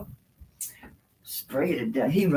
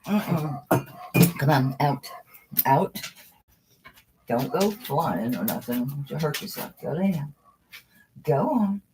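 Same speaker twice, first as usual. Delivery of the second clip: normal talking voice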